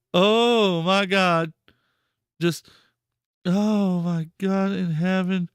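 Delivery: slow drawn-out oh my god; stunned slow disbelief. Real, not acted. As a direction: drag it out, speechless. Repeated stunned groans.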